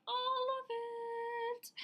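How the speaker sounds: drawn out, high excited sing-song. Real, not acted. Showing viewers a big book haul, excited sing-song. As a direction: sing it out high and slow with glee.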